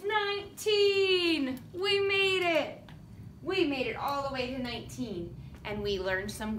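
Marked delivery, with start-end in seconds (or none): 0.1-2.8 high, drawn-out celebratory call; triumphant finish. Real, not acted. Teacher finishing the count with children, triumphant.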